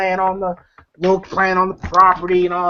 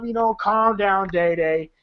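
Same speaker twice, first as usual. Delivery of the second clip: ordinary delivery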